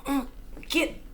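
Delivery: gasping words broken by a cough; choking, coughing. A narrator's reading. Burglar choking on the gas, coughing out the line. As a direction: choke the words out through the fumes.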